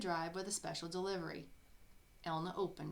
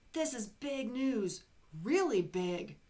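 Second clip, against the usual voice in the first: excited editor; 4 semitones higher, 7 dB louder, slower than usual. Acted. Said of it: "said the newspaper editor".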